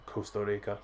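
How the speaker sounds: soft stab at a Costa Rican accent, tapped R; attempted Costa Rican twang. Shown as a demonstration. Host admiring a viewer's accent, attempted Costa Rican twang. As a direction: try Costa Rica with a local Spanish twang. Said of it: "I can't put the, the twang on it".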